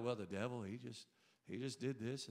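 mock whiny excuse. Pastor mocking people who blame the devil, a soft low grumble. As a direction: lightly mimic someone blaming the devil. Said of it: "People act like".

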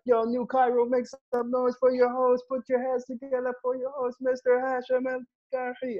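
high-pitched self-introduction as show announcer; hype-man announcer. Acted. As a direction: hype up the crowd for the host. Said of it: "I'm gonna introduce myself".

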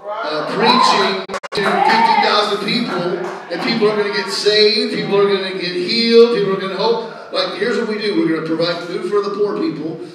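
loud, rallying crusade preaching; full-force preaching. Real, not acted. Pastor announcing Brazil crusade to his church, loud and fired up. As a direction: proclaim it over the congregation's response.

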